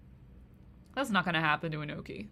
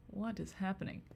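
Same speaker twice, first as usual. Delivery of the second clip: hushed, baffled whisper; whispered disbelief; 7 dB quieter than usual. Real, not acted. Streamer reads a weird question to chat, whispering in disbelief. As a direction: breathe it out in quiet confusion.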